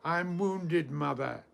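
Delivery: restrained poetic shout; narrated shout. A narrator's reading. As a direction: call out in hurt, as a storyteller would. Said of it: "he shouted".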